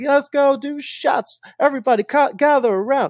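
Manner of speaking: rally the crowd to take shots; party hype voice. Retold or imitated. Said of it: "be like".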